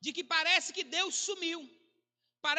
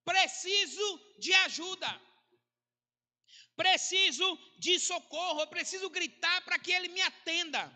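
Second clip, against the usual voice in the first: raised, higher, insistent plea; desperate crying out; 4 semitones higher, 4 dB louder than usual. Acted. Preacher voices the psalmist begging God for help, crying out desperately. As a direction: cry out to God for help, pitched up. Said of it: "que estava gritando, dizendo assim".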